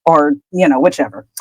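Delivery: plain reading voice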